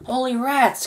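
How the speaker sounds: sharp, high exclamation of shock; cried out. Acted. Shanks crying out in shock at the glass box. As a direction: blurt it out in alarm. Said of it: "cried Shanks".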